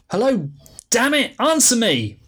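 "Hello?" falling, then angry high shout; rising frustration. Acted. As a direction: snap at the silent phone, building anger. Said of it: "increasingly frustrated".

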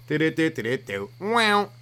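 high sung video game jingle; vocal imitation of a game sound effect; Super Mario sound. Shown as a demonstration. Comedian mimicking Super Mario game noises, playful jingle. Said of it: "That's Super Mario".

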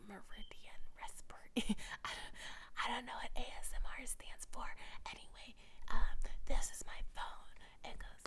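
ASMR whisper with breaking giggles. Shown as a demonstration. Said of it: "whenever I whisper".

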